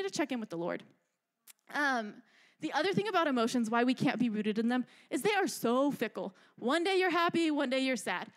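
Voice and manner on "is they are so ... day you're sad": normal talking voice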